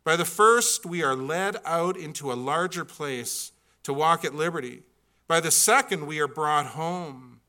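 fervent pulpit reading; preaching at full force. Acted. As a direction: proclaim the quote with swelling force. Reads quotation with rising force.

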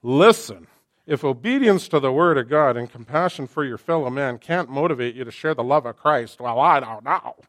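put-on loud, indignant young preacher; snotty retort. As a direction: lecture the critic in your head. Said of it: "I thought".

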